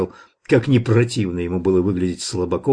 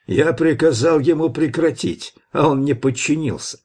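higher-pitched, lightly indignant justification; indignant ruler; 7 semitones higher than usual. A narrator's reading. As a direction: justify yourself as the one who gives orders, pitch raised. Red Bat justifies killing indignantly. (возмущённо, повышенным тоном)

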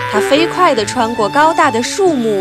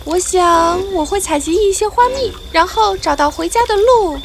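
bee voice; 6 semitones higher than usual. Acted. Maya talks to grasshopper.

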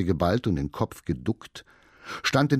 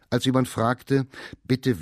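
usual speaking voice

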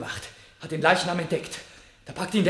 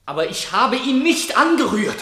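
raised, insistent denial, pitch climbing; louder, higher pleading protest; desperate protest; 6 semitones higher, 7 dB louder than usual. Acted. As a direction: shout the denial to be believed. Accused man protests innocence.

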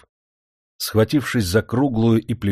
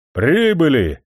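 loud call; 6 semitones higher, 4 dB louder, slower than usual. A narrator's reading. Coachman announces arrival.

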